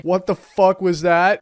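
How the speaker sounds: loud, startled, slow shout. Real, not acted. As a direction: shout in shock. Zombie surprise in game.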